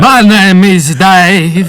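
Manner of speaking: very slow, high, loud mock Russian with a laugh; half-laughing fake Russian accent; hammed-up Russian intro. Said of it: "not a Russian accent".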